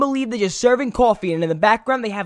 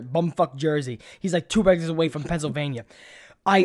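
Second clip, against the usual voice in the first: ordinary delivery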